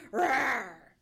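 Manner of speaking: loud dog growl; loud snarling roar; ugly growl. A narrator's reading. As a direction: roar like an attacking little dog. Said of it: "with an ugly growl".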